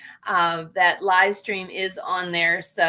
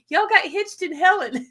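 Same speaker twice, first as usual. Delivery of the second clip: playful rhyme; 8 semitones higher than usual. Real, not acted. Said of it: "that rhymes".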